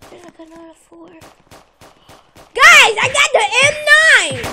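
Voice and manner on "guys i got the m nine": loud, high shout of triumph; excited shout. Real, not acted. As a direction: yell it to the team, thrilled. Excited over new gun.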